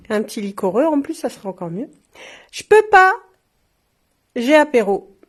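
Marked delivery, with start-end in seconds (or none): 2.5-5.1 high, loud exclaimed slogan; punchline. Shown as a demonstration. Shop owner reading a funny glass slogan, loud and high. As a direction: call it out like a happy excuse.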